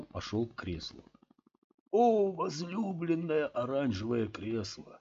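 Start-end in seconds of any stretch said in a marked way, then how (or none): 1.9-5.0 slow, pompous tipsy salute; mock-solemn drunken address; drunk grand greeting. A narrator's reading. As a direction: hail it like a drunk poet. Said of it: "приветствовал его Скотт".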